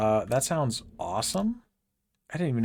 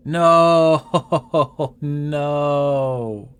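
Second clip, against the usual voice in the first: loud, pained cry of disbelief; dismayed shout; 4 semitones higher, 11 dB louder than usual. Real, not acted. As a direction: yell it like the loot just betrayed you. Dismayed at finding quarterstaff.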